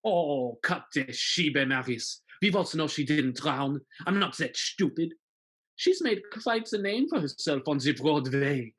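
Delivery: sneering villain, smooth and menacing; scheming villain. Pretend. Von Turpin threatening Marius, in a sneering villain voice. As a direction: gloat over the hero's secret.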